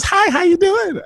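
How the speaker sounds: put-on high sweet girlish voice; girlfriend voice. Bethany answering his phone call, high girlish. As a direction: sing it up high like a teen girl. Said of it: "She says".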